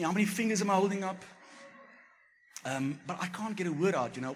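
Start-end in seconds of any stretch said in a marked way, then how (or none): 0.0-1.2 quick, higher, louder fussing question; wife testing if he's concussed, fast and anxious. Retold or imitated. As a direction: rush the question, frantic. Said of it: "Asking me".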